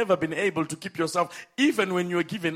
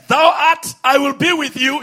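voice of God; 6 semitones higher, 9 dB louder than usual. Acted. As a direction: proclaim it as God speaking. Preacher voicing God's promise.

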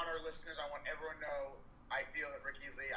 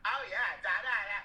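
mock chatty patron; 5 semitones higher, 7 dB louder than usual. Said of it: "Jackie being like".

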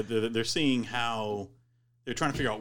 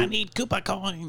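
character voice; 9 semitones higher, slower than usual. Retold or imitated. Quoting Big Bertha.

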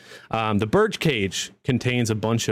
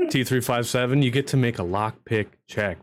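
ordinary delivery

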